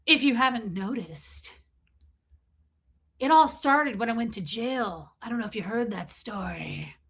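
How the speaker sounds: smug, trailing into a whisper; mock Aaron as Ron. Host mocks Aaron's jail story, smug then whispered. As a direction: act cool and let it fade. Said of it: "He's like".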